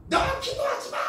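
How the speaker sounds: very high demonic shriek; acted demon voice, shrill and forceful; possessed child's voice. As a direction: screech the order like a demon. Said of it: "갑자기 입에서 이 아이가".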